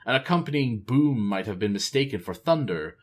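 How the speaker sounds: usual speaking voice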